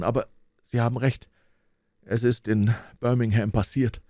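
high, baffled disbelief; a flustered banker, caught off guard; stunned. A narrator's reading. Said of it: "völlig entgeistert". (völlig entgeistert)

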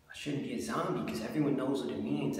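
usual speaking voice

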